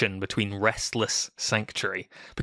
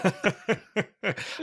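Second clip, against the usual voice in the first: bright burst of laughter; high laugh; 9 semitones higher than usual. Real, not acted. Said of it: "wondering how long it would take".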